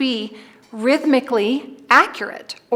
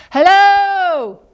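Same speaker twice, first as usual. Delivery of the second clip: higher, louder echoing hello; the echo calling back; 8 semitones higher, 6 dB louder than usual. Acted. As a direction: answer like a distant echo. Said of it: "said the voice".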